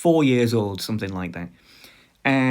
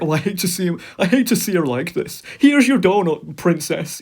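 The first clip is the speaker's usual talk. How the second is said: high, fretful mock doting parent; caving-in parent; 6 semitones higher, 4 dB louder than usual. Parent gives in after a tantrum, mock anguished coddling. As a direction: give in to the child in a raised, pleading voice.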